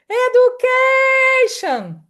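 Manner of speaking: long, high, loud call with stretched stressed syllable; drawn-out, high-pitched calling demo. Shown as a demonstration. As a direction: call it out as if across a distance. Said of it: "But if I say it".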